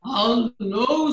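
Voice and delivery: loud wolf voice; werewolf. Shown as a demonstration. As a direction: growl it out like a big bad wolf. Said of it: "said the wolf".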